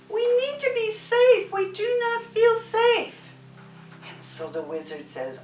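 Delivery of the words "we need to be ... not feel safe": high, small worried voice; anxious frogs. Acted. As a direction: plead to feel safe, high and small. Frogs tell wizard their fear.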